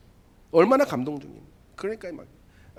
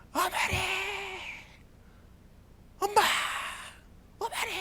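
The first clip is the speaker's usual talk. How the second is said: crying out for mother; 8 dB quieter than usual. Retold or imitated. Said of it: "막 울면서 너무 힘드니까".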